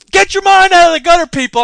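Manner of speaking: loud, high mock scolding; teasing shout. Real, not acted. Host playfully scolds British listeners, mock shout. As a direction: call them out with a playful yell.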